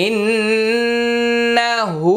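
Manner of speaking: ইন্নাহু chanted slowly with held nasal ন্ন; recited with ghunnah. Shown as a demonstration. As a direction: hold the ন্ন in ইন্নাহু as a long hum. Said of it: "নুনে তাসদিদ পেয়ে গেলাম". (ইন্নাহু শব্দে ন্ন গুন্নাহ দিয়ে টেনে পড়া)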